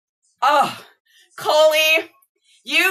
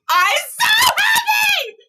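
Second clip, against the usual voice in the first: overjoyed squeal; slower than usual. Real, not acted. Thanking her friend for the gift, overjoyed high cry. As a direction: voice jumps up into a joyful cry. Said twice, second breaks.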